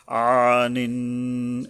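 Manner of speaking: "আনিন" chanted slowly with the final n hummed long; nasal ghunna. Shown as a demonstration. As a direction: hold the last n of "আনিন" through the nose. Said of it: "এখানে গুণ্য আছে".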